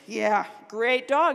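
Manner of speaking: slow, low, eye-rolling mock praise; dismissive. Dad dismissing talk of a beloved dog, dismissive. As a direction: imitate an unimpressed dad humoring someone. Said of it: "he's rolling his eyes".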